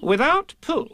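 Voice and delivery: high, solemn Rabbit; solemnly. A narrator's reading. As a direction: Rabbit making a grave pronouncement. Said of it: "said Rabbit solemnly".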